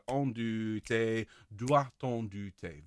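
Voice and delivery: doit-on du thé with a tiny soft T joining doit and on; soft elided t. Shown as a demonstration. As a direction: join doit-on with a very soft T, slowly. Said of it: "Very, very soft".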